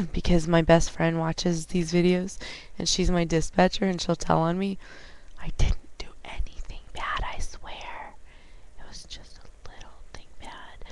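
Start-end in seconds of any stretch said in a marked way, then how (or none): 5.4-10.8 hushed confession; secretive whisper. Real, not acted. Driver hiding a mishap from her dispatcher friend, whispering. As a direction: whisper it so the friend won't hear.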